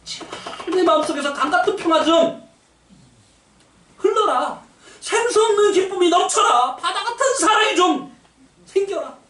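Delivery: high, imploring prayer voice, voice lifted high; earnest pleading prayer. Retold or imitated. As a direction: beg God for peace, half in jest. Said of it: "간절한 마음으로". (높은 목소리로 간절히 비는 기도)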